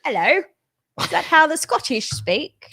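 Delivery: a clumsy attempt at a Scottish accent that drifts toward East London; hammed-up fake Scottish accent, brogue badly missed. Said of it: "That could be the worst Scottish".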